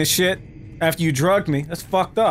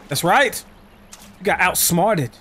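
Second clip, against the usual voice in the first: normal talking voice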